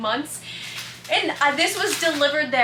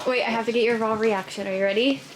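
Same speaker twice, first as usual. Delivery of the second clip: normal talking voice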